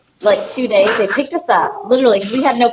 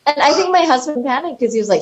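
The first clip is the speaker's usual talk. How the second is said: ordinary talk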